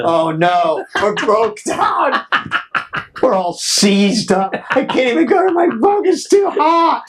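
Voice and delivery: high, strained yell about the heat; mock panicked yell. Band on broken tour bus, hammed-up panicked yell. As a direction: whine it like you are melting on the bus.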